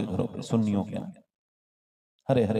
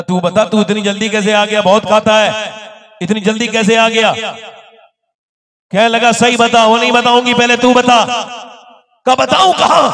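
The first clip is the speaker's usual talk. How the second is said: high, loud fervent preaching voice; full-force preaching; 12 semitones higher, 14 dB louder than usual. Acted. (पूरे जोश में तक़रीर)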